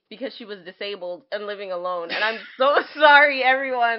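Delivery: giggling through an embarrassed sorry; apologizing mid-laugh; laughing apology. Real, not acted. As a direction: laugh it off, sheepish. Laughing at own misreading.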